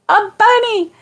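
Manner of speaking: high, delighted squeal of the word; light up at the sight; excited. Real, not acted.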